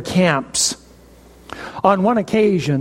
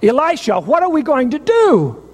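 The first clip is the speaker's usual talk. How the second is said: frightened plea, pitched up; panicked cry; 6 semitones higher than usual. Retold or imitated. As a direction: voice rising in panic, higher. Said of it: "he cried out".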